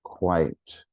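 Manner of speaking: "quite" in one single falling beat; one-syllable QUITE. Shown as a demonstration. Teacher demonstrates counting syllables to teachers, one-syllable "quite". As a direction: say "quite" as one syllable, nothing between vowel and t. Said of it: "One syllable".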